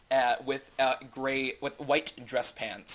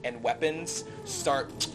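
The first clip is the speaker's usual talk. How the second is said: ordinary delivery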